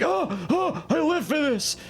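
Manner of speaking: very high mock-ecstatic squeal; overexcited little child. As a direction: act out a toy-crazed kid, way up high. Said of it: "As a little child, I was like".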